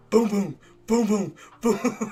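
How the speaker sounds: slow heavy booms like a pounding heart; heartbeat imitation. Trader's nerves after pressing buy, slow booming heartbeat. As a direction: thump out each boom, slowly. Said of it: "Your heart doing that".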